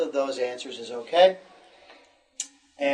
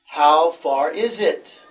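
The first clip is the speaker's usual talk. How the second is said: "How far is it?" said slowly, louder, weight on "How"; slow louder model question; 7 dB louder, slower than usual. Shown as a demonstration. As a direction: lean on "How", slow and clear. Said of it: "Yes, very good".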